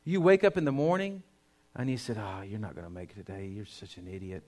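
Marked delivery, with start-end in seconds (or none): 2.2-4.4 muttered self-insult. Acted. Voicing self-cursing morning thoughts.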